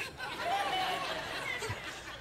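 sudden high laugh at himself; high burst of laughter. Real, not acted. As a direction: laugh out at the memory. Laughing at own story.

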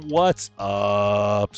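ordinary delivery

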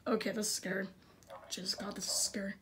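fast, breathless whisper; scared whisper. Real, not acted. Gamer whispering in fear at a scary scene. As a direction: whisper it as if it could hear you.

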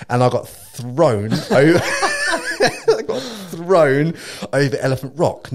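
loud, stretched-out storytelling through laughs; laughing exclamation. Real, not acted. Surfer telling friends he was thrown over rocks, laughing exclamation. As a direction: hit the climax laughing, dragging out the words.

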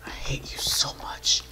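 very soft whispered loathing; seething whisper. Real, not acted. Player seething at the monster, hateful whisper. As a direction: whisper it with pent-up frustration.

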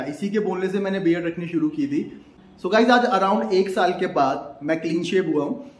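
ordinary talk, nothing marked

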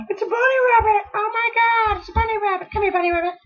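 very high, thrilled squealing voice; excited squeal. Real, not acted. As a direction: squeal with delight at the bunny. Thrilled to find a bunny.

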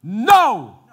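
loud sharp no; commanding shout. Retold or imitated. Angels stopping the mob, loud command. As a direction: stop them dead. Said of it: "You know what the angels ended up doing?".

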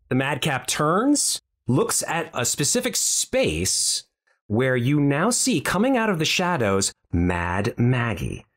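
ordinary talk

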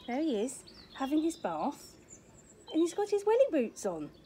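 ordinary delivery

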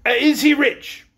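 a touch of nervous seriousness; serious, anxious. A narrator's reading. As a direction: ask it slowly with mild unease. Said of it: "in a serious, anxious voice".

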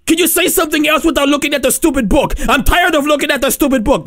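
high, exasperated rant; mock anger. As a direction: rant at someone who keeps repeating themselves. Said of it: "getting angry".

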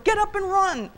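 high, loud urgent order; high-pitched exasperated shout; hollering. A narrator's reading. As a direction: holler at him to flee. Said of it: "old Granddad hollered".

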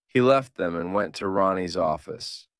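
ordinary delivery

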